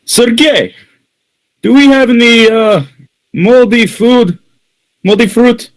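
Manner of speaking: very high-pitched server's call; calling to a colleague. Acted. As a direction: pitch it way up to ask the kitchen. Server calls question to colleague Sergey.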